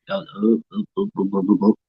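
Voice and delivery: choppy, broken-up robot sound; like a frozen video call; glitchy robot voice. Real, not acted. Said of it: "now you're doing robot voice".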